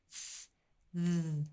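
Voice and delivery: unvoiced "th" then voiced "th"; th sounds demonstrated. Shown as a demonstration. Teacher modelling "th" for children, unvoiced then voiced. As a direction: breathe out "th", then voice "th". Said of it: "you can feel the voiced part with your voice box".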